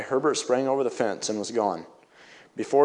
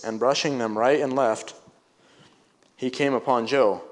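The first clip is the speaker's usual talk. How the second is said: ordinary delivery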